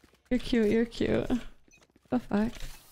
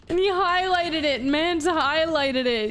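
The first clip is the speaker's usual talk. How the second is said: higher, drawn-out amused disbelief; 5 semitones higher, slower than usual. Real, not acted. Streamer telling chat about a viewer's highlighted message, incredulous. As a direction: marvel at the cheek of it, pitch raised.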